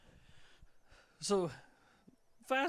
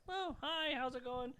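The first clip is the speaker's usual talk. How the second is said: higher-pitched mock friendly greeting; lightly voiced girls saying hi, pitched up; imitating chatty girls; 6 semitones higher, 5 dB quieter than usual. Girls chatting up a drunk guy on pallets, imitated friendly greeting.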